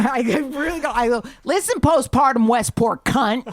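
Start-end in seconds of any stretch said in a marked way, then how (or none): 1.4-3.4 loud, high insult hurled at someone; angry confrontation. Retold or imitated. Passenger turning round on the mother, angry and loud. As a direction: tear into them, loud and high.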